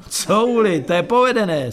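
high, loud mirthful exclamation; amused scoffing. A narrator's reading. Sewer worker mocks the newcomer's question about hives, amused. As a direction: exclaim as if tickled by the absurd question. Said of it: "smál se ten tchoř".

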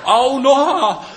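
very high, loud yelp of pain; shrieking pained ouch; roar of pain. A narrator's reading. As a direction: howl as if bitten. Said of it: "zařval bolestí pták Noha".

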